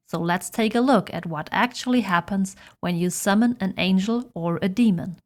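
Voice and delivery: ordinary talk, nothing marked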